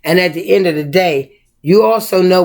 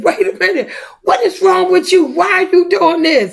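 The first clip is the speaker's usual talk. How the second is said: much higher, fussing concern; alarmed at the plant; 11 semitones higher than usual. Retold or imitated. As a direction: demand answers from the drooping plant, high. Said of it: "I said".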